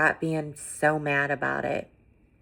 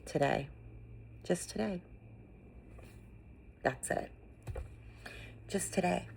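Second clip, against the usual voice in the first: hushed reassurance; 3 semitones higher, 5 dB quieter than usual. Real, not acted. Speaker to grieving viewer, gentle near-whisper.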